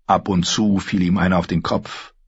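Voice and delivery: ordinary delivery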